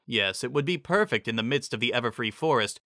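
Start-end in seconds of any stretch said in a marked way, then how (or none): none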